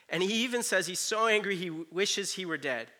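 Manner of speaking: ordinary talk, nothing marked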